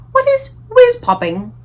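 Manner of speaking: a queen asking in bafflement; puzzled Queen. A narrator's reading. The Queen asking Sophie what whiz-popping is, high and slow.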